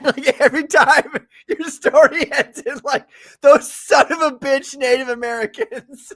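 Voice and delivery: loud and high through real laughter; laughing hard. Real, not acted. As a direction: crack up while delivering the mock curse. Cracking up, mocks how stories end.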